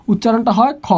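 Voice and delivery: usual speaking voice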